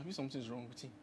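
soft, low, half-whispered concern; hushed worry. Retold or imitated. Father telling his wife he fears their son is sick, hushed. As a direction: murmur it like a worried dad at dawn.

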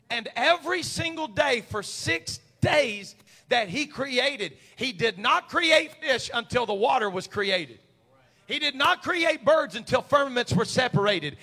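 high, loud preaching building to a full shout. Real, not acted. Preacher telling congregation not to fear tomorrow, full-force preaching. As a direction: build to a roaring pulpit climax.

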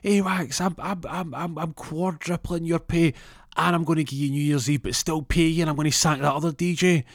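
high-pitched mock shout of an excited manager; retold shout. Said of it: "the manager shouts, uh, shouts over".

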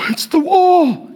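high, slow hammed-up wail; put-on weepy voice, drawn out; mock emotional. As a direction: mimic a pilgrim overcome with tears. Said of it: "they get very emotional".

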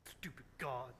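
low, sulky grumble, acted out; mock pout, muttered low. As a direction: sulk like a child after a tantrum.

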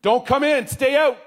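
loud, high-pitched yell; urgent shout across a room. A narrator's reading. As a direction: shout the warning out, pitch jumping up. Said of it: "all the students in one voice yelled".

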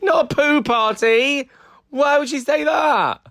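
high, slow incredulous protest; amused disbelief. Real, not acted. Friend protesting she never said poo party. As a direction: pitch way up in laughing disbelief. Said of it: "are you serious".

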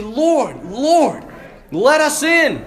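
rising plea at a locked door; pleading. Acted. Locked-out wedding guests beg to enter, pleading. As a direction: call out, building with each phrase.

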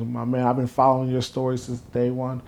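ordinary talk, nothing marked

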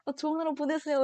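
just blurt it out nervously. Retold or imitated. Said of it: "그냥 냅다".

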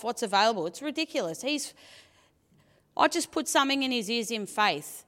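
ordinary talk, nothing marked

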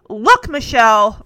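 loud, slow, insistent address; loud into phone. A narrator's reading. Robin cuts in on the phone, speaking loudly. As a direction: say it forcefully down the line. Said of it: "Robin said loudly into the phone".

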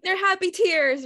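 high, loud, bright-eyed mock insistence; cheery insistence. Host mimicking guest explaining her crying, high and loud mock cheer. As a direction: chirp it up high and loud like everything's fine. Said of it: "You're like".

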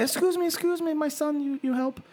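put-on very high woman's voice, rough Brazilian accent; attempted Brazilian accent. Brazilian mother asks passenger to help her son, attempted Brazilian accent. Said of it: "Is that a Brazilian accent?".

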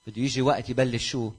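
usual speaking voice

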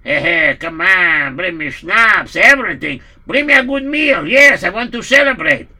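loud, demanding nobleman calling for wine; boisterous landowner. Acted. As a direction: bellow orders for a feast. Poritz demanding wine, celebrating.

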